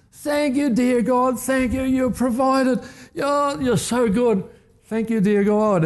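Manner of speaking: overflowing with joy at the gift, calling out thanks; ecstatic praise. Retold or imitated. Widow at the groceries under the mango tree, ecstatic shouting praise. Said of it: "she just goes to town".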